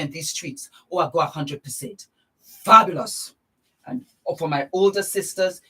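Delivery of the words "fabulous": loud, savoured fabulous; gushing fabulous. Real, not acted. Vlogger showing off the result, loud and gushing. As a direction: relish the word. Admiring tan.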